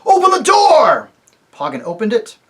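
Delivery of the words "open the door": loud high commanding shout. Acted. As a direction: bark the order as you hurl the ape.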